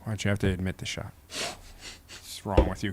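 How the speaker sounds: soft, slow hissed reproach; hushed and unvoiced, scolding him for the admission. Real, not acted. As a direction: chide him under your breath. Scolding partner after admission.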